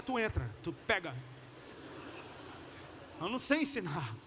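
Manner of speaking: ordinary talk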